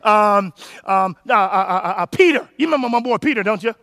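usual speaking voice

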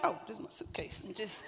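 soft, breathy mock sigh over the suitcase; half-whispered aside. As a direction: shrug it off under your breath. Mocking a lazy unpacker.

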